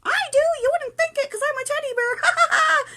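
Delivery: very high, loud squeaky bear with a laugh; teddy bear voice. Pretend. Teddy bear confessing to its friend, squeaky gleeful voice.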